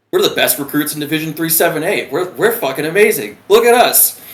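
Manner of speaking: crowing, rising to a loud high finish; cocky boasting. Retold or imitated. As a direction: build into a triumphant mock brag. Recruits boasting, on top of the world.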